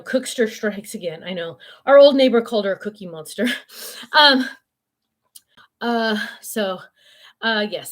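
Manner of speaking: ordinary delivery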